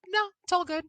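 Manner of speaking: chirpy dismissal. Retold or imitated. Telling friends she's not nervous, high and breezy. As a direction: brush it off cheerfully. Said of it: "I'm like".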